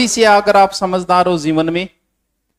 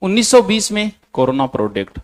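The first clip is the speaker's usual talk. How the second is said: measured note dictation with long pauses. Real, not acted. Teacher reading out notes for students to copy, dictation. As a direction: dictate phrase by phrase for students to write.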